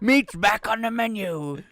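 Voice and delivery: monster voice. Acted. Joking ogre line, laughing.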